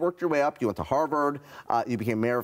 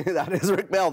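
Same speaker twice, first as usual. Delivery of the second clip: laughing; 7 semitones higher than usual. Real, not acted. Host laughs repeating guest's line.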